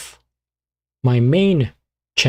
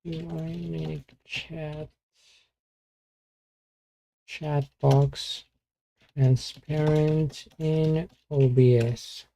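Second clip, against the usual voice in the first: muttering softly; 3 semitones higher, 8 dB quieter, slower than usual. Real, not acted. Typing a question quietly.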